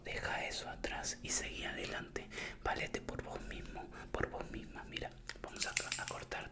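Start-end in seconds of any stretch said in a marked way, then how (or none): none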